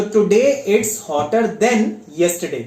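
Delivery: slow and deliberate, word by word; teacher's slow example read; slow dictation. Shown as a demonstration. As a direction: spell it out slowly for the class. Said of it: "teacher dictates example sentence".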